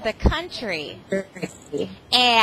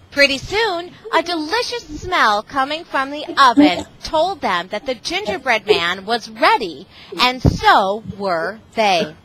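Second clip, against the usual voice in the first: storybook narration, a bit louder and more lively; reading to children; 6 dB louder than usual. Acted. Guest reader resuming the story for the class, lively storybook voice. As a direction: read it out brightly for the class. Reads picture book to children.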